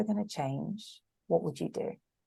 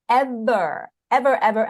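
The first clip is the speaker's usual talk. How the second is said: louder and higher, hammering each ever; emphatic ever; 5 semitones higher, 7 dB louder than usual. Real, not acted. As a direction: pound every ever.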